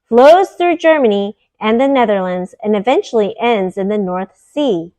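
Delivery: bright, clear storybook read-aloud voice; reading to children. Acted. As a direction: read it like a bedtime picture book for kids. Reads picture book aloud.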